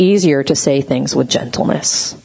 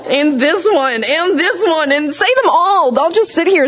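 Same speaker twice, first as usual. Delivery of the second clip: high whimpery begging, half laughing; mock tearful pleading; 10 semitones higher than usual. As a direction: plead like someone needing comfort, hammed-up. Begging for comfort cards, acted.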